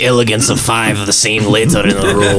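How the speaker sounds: hammy pirate captain grumbling; a gruff old sea-dog's complaint. Acted. Captain character in a pirate voice again.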